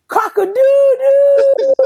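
high, slow, loud crowing through laughter; rooster crow impression. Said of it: "when Chris nut, he say".

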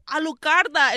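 hammed-up scream, held back from full force; mock scream. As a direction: cry it out, imitating a screamer.

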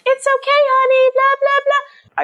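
very high, sugary imitation of comforting; mock soothing. Imagined midwife to woman in labor, mock cooing reassurance. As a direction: coo it in an exaggerated high voice.

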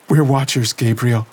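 put-on high, tense young woman's voice reliving horror; Lena shaken. A narrator's reading. Lena telling Gabriel what she felt, shaken and tense. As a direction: voice Lena trembling through the vision.

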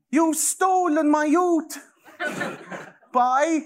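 put-on high, slow, squeaky parody of a teen activist; mock Greta voice. Mocking Greta to his podcast friends, in a high slow squeak. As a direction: lampoon her with a high, slow quavering voice.

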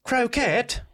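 high, puzzled fancy English. Acted. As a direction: query it in posh English. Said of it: "Is this fancy English".

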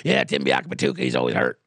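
deep and sure of himself, getting it wrong; Scott Farrell voice. Retold or imitated. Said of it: "he said something like".

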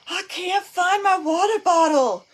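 high, loud, slow imitation of a whining child; whiny kid voice. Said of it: "They stand in the middle of the kitchen like this".

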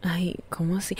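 breathy hushed "ay, ¿cómo así?"; soft mock surprise under the breath; whispered aside. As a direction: drop to a whisper, playfully shocked.

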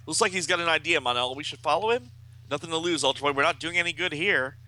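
mildly dramatized team dialogue; comic-book hero. A narrator's reading. Voices comic-book characters conferring.

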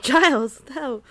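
loud exasperated Giles, no; exasperated shout. Retold or imitated. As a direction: yell it in exasperation. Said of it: "and she's like".

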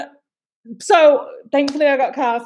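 ordinary talk, nothing marked

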